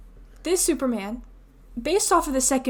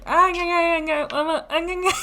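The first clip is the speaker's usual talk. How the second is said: higher, nasal-sounding babble imitation; mock garbled line; 5 semitones higher than usual. Imitating phone booth guy's only line, mock babble. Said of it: "his line was just like".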